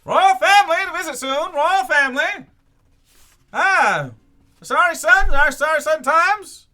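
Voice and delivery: loud high hawking cry, stretched out; newsboy call. Acted. Newsboy hawks the Asari Sun to passersby, loud call. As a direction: cry the headlines to the street like a paperboy.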